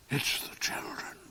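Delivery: soft, breathless croak; croaked whisper. Acted. The Duke croaks in fear of the children upstairs. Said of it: "croaked the Duke".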